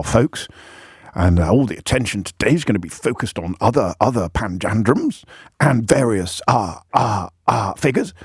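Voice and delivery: lightly done posh bumbling politician, full of uhs; Boris Johnson impression. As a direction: put on a bumbling Boris Johnson voice for a mock speech. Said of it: "That was quite good for a while, that impression".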